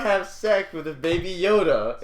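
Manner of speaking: tag. Real, not acted. Mock-outraged scolding over Baby Yoda.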